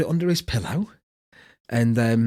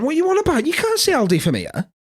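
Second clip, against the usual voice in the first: incredulous; 13 semitones higher than usual. Retold or imitated. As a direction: throw the question up in total disbelief. Dad incredulous at son's I Spy answer.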